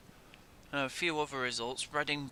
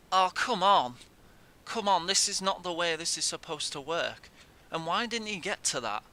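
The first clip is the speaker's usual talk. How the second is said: real exasperated complaint, louder; frustrated outburst; 4 semitones higher, 4 dB louder than usual. Real, not acted. Gamer moaning at his defence after a goal, real exasperation. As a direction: moan loudly at the screen.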